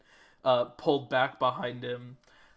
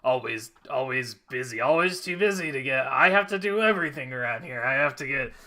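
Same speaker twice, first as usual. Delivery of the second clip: grumbling; 2 semitones higher, 6 dB louder, faster than usual. Acted. Genasi grumbling about doing everything, fast grumble. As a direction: grumble your complaints quickly.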